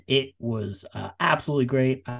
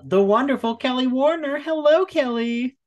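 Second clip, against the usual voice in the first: excited welcome; 13 semitones higher, 3 dB louder than usual. Acted. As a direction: lift the voice for a grand welcome. Host introduces guest grandly.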